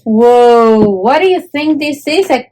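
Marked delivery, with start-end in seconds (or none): none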